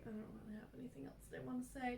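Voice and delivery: too worn out to say it properly; drained mumble. Retold or imitated. End of podcast, too drained to talk, mumbling. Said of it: "We're like".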